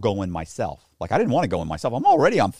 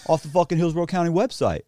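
raised, heated accusing voice; angry accusation; 8 semitones higher than usual. Retold or imitated. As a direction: confront him hotly. Angry Eric confronting him.

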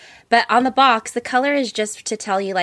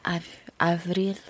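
mock French try; 2 semitones lower than usual. Teasing a friend's attempt, clumsy French "[name]". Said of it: "You're like".

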